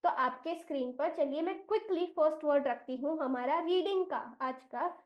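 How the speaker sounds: normal talking voice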